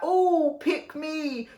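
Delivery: high mocking pick-me plea; mock eager plea. Rapper mocks opponent as a needy show-off, high mock plea. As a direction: send him up as a needy kid begging to be chosen. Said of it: "Don't flop hat and hoodie like".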